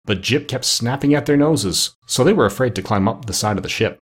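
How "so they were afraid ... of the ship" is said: ordinary delivery, nothing marked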